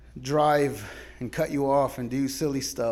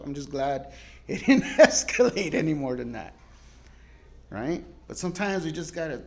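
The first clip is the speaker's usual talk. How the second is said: ordinary talk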